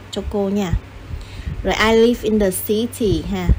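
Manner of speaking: ordinary talk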